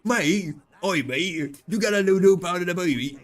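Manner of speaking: high, hammed-up Australian mate voice; loud, clumsy Australian accent; bad Australian accent. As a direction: call out like a cartoon Australian. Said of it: "I can't actually do an Australian accent".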